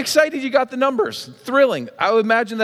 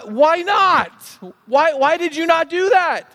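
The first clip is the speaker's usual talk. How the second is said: high-pitched baffled questioning; higher, exasperated disbelief; acted incredulity; 8 semitones higher than usual. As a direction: play a listener who can't believe it. Acting baffled listener questioning the winner.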